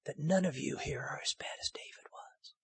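breathy whisper to the congregation; hushed whisper. Real, not acted. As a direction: drop it to a secret whisper. Preacher drops to a whisper.

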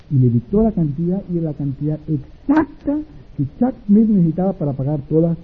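ordinary delivery

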